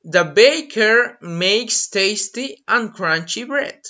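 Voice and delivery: slow, clear example sentence; carefully paced demo line; slow model sentence. Shown as a demonstration. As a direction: read it slowly for learners. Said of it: "So you can say".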